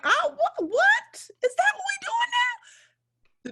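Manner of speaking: very high, slow laughing squeal; laughing disbelief. Retold or imitated. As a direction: cackle in amused shock. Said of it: "I start cracking up".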